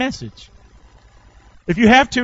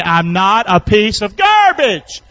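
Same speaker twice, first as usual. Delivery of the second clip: louder; 5 dB louder, slower than usual. Shown as a demonstration. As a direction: belt it out for the congregation to repeat, stretching "garbage". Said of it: "Say it a little bit louder".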